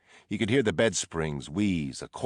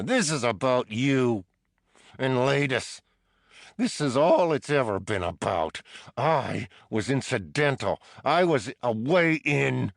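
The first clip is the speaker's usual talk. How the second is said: higher, louder accusation; furious prisoner; 7 semitones higher, 5 dB louder than usual. A narrator's reading. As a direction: hurl the blame at him.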